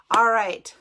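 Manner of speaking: a sudden loud "All right" that falls at the end; loud call. Real, not acted. Host calling viewers back, loud "All right". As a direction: call the room back to order.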